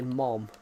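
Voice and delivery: "mom" low with an open ah; American pronunciation. Shown as a demonstration. Repeating how American people say it, American "mom". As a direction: imitate American "mom", vowel held open. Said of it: "American people say mom".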